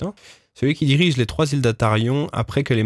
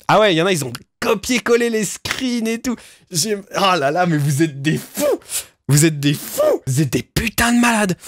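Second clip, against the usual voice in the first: high, excited disbelief, half laughing; amazed outburst; 6 semitones higher than usual. Real, not acted. Streamer stunned by fans' collaborative document, excited high exclamations. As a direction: rave at them in delighted shock.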